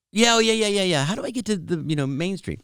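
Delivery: high, rapid-fire string of yeahs; flustered reply. Retold or imitated. Lost driver asking a stranger for directions, flustered.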